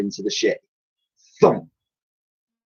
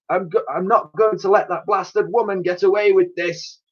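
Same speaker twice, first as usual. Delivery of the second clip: put-on huffy old admiral; 10 semitones higher than usual. Admiral putting the girl in her place, pompous. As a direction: puff up like an offended old sailor.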